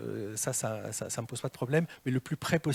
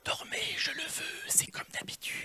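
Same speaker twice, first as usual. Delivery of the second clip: mock hypnotist whisper; 7 dB quieter than usual. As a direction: lull them to sleep in a whisper. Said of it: "quand un jury entend ça". (chuchotement d'hypnotiseur moqueur, lent)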